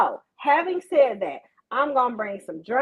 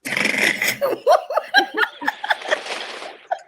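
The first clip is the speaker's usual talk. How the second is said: airy giggle breaking the silence; a long wheezy high laugh; high breathy laugh. Real, not acted. Laughing at the awkward silence, high airy giggle.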